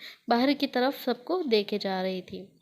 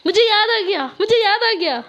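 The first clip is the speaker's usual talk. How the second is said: loud excited shout, much higher; चिल्लाते हुए; 9 semitones higher, 8 dB louder than usual. A narrator's reading. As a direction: yell it out in sudden excitement. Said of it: "वो चिल्लाते हुए बोलती है". (ज़ोर से चिल्लाते हुए)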